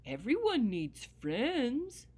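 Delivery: dog telling the grumpy leprechaun everyone needs friends; gentle dog voice. Acted. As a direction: coax him kindly like a hopeful pup. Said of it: "the dog said".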